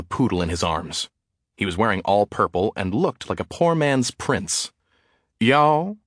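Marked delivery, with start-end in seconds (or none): 5.4-6.0 high, loud Southern drawl; Southern drawl, loud and lifted. A narrator's reading. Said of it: "he said in a southern drawl".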